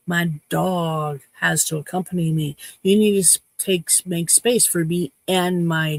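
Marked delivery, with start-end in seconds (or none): none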